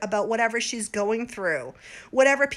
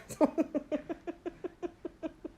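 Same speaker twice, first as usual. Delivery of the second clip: high giggling fit; 6 semitones higher, 6 dB quieter than usual. Real, not acted. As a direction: breaks into a tipsy giggle. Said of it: "I don't mean to laugh".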